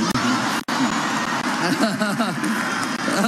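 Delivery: a long, low, full laugh; hearty laugh at own punchline. Real, not acted. Preacher laughing at his own airplane joke, hearty laugh.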